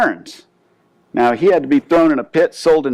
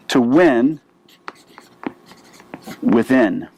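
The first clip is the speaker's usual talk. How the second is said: hushed emphasis; 4 semitones lower, slower than usual. Real, not acted. Sermon's key phrase hushed.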